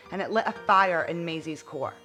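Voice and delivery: plain reading voice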